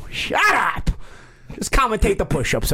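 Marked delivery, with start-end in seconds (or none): 0.1-0.9 high playful shout; mock shout. Real, not acted. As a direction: yell it back at a teasing friend. Playful retort to teasing friend.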